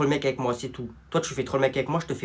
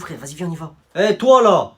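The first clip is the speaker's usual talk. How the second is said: ordinary talk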